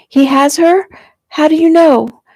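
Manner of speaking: high, loud frantic questions; panicked. A narrator's reading. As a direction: demand answers in panic. Panicked girl questions her brother.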